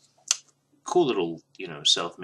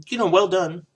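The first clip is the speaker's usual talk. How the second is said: excited; 14 semitones higher, 11 dB louder than usual. Real, not acted. Record praise, burst.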